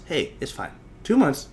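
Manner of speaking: ordinary talk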